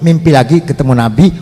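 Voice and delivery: ordinary delivery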